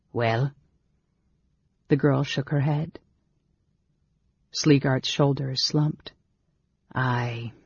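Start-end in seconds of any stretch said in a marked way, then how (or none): 0.1-0.5 low, gruff one-word question. A narrator's reading. Innkeeper questioning his servant girl, gruff. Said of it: "he said gruffly". 6.9-7.5 low, soft mutter. A narrator's reading. Innkeeper taking bad news, muttered. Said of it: "he muttered".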